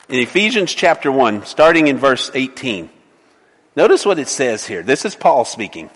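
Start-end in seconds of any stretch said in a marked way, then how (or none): none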